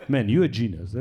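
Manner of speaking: low, unimpressed mock praise; deadpan sarcasm. Expat answering a Dutch native speaker, deadpan sarcasm. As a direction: praise them with dry, feigned awe.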